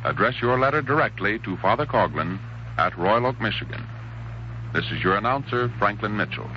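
normal talking voice

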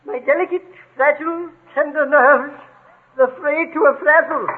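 high, fretful moan; hammed-up high-pitched self-pity; self-pitying lament. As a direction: pity your poor nerves word by word. High-strung man laments his nerves.

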